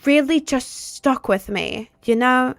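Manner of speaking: hammed-up English accent of a returning tourist; mock English accent. Mocking someone back from a week in England, put-on English accent. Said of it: "I'm not trying to be, like, a larp".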